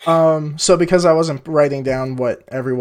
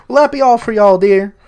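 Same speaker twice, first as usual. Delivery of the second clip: put-on high, louder old lady asking sweetly; old woman; 6 semitones higher, 6 dB louder than usual. Elsa sets down the ales for the party, high old-woman voice. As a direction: pitch up as the elderly innkeeper's wife.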